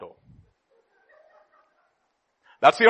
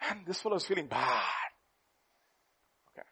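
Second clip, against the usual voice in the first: usual speaking voice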